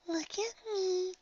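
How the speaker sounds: high, soft, slow demand; cross little pony. A narrator's reading. Shy pony annoyed at giggling friend, softly cross. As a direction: demand it in a tiny voice. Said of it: "She looks mad".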